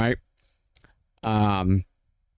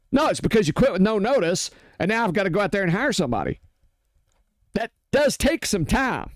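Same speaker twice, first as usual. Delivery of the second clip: indignant, pitch well up; exasperated rant at quitters; raised-pitch rant; 6 semitones higher than usual. Real, not acted. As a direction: argue back hard. Ranting rebuttal.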